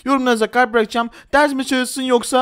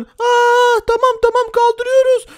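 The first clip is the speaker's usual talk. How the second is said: high, frightened pleading voice; panicked high-pitched surrender; scared bank teller; 12 semitones higher, slower than usual. Acted. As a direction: squeal it out like a terrified hostage. Frightened bank staff at gunpoint.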